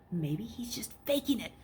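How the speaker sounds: hushed, voiceless whisper. A narrator's reading. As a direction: whisper it to yourself. Luke's inner thought.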